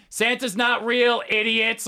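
put-on high, slow bratty child; bratty kid voice. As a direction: sneer it like a five-year-old. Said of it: "kids in kindergarten will be like".

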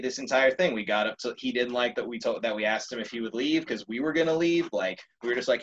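ordinary delivery

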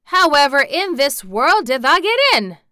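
high, loud incredulous question; loud Yorkshire astonishment; bursting with curiosity. A narrator's reading. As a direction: burst out, baffled and demanding. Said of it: "burst out because he was so overpowered by".

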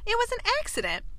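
high-pitched narrated protest. A narrator's reading. Kate insisting to Anthony, protesting.

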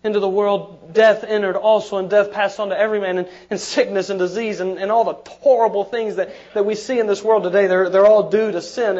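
loud, fast pulpit build; rising fervent preaching; building sermon climax. Acted. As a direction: preach it up to a shout.

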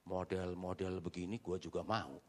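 low, hushed aside to himself; soft, low inner voice; retold private thought. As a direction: murmur it low, like a guilty secret. Confesses his private lustful thought.

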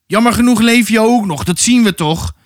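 narrated angry yell; shouting. A narrator's reading. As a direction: yell it across at the rival. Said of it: "en schreeuwde".

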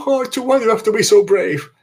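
high, fast prissy robot voice; fussy droid voice. Retold or imitated. Fan mocks the golden droid's fussy lament.

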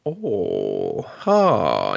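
low, drawn-out posh "Oh, ha"; drawn-out gentleman voice. Retold or imitated. Imitating Jack the Ripper's drawn-out delivery. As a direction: stretch it like a smug gentleman. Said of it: "drawn out".

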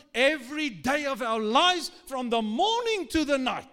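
preaching at full force. Real, not acted. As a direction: build it up into a loud preach. Preaching builds to loud climax.

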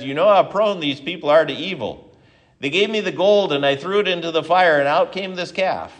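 Aaron's lame excuse. Retold or imitated. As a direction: plead innocence like a caught little brother. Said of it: "one of the worst excuses ever uttered".

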